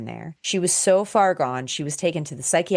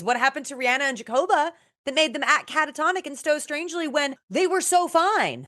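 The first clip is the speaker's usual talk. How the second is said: mock mystery; 10 semitones higher than usual.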